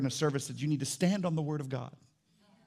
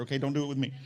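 ordinary talk, nothing marked

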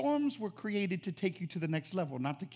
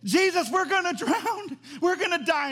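panicked shouting; 9 semitones higher, 6 dB louder than usual. Acted. Disciples to Jesus in the sinking boat, acted panicked shout. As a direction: cry out as if going under. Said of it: "The disciples woke him up shouting".

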